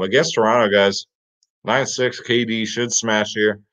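ordinary delivery

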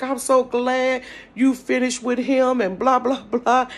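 high, loud, slow gushing; excited lawyer. Retold or imitated. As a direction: gush like a happy lawyer. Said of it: "He was like".

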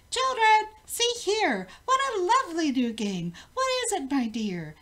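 high, loud and delighted; excited cry. A narrator's reading. Queen calls her children to the new game, delighted and loud. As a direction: call out to the children with glee.

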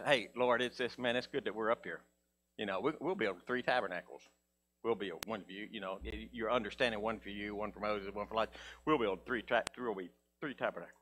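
low, soft mock Peter voice; lightly voiced eager Peter; Peter's voice. Preacher voicing Peter to Jesus, low and soft.

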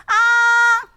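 아 high, level and long; exaggerated first tone. Shown as a demonstration. Teacher repeats for the class, high held 아. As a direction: sustain 아 on one overdone high note. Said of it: "되게 오버한다고".

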